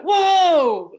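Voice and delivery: loud, high mock whoa; imitated shout of surprise; amazed whoa. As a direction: act out a stranger's jaw-drop. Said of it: "they'd be like".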